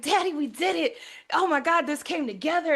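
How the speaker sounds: high, gushing celebration to dad; excited daughter. Retold or imitated. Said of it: "I'm so excited".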